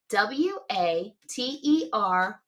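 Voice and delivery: slow, tapping out each letter; spelled letter by letter. Shown as a demonstration. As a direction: spell water one letter at a time. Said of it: "Watch me".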